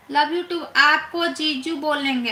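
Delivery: usual speaking voice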